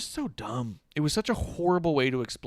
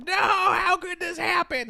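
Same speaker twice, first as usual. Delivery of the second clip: high, slow, mock-horrified wail; mock despair; 3 dB louder, slower than usual. Host mocking Eddie's dramatic reaction, high mock wail. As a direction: ham up a distraught hero's cry. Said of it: "He's looking like".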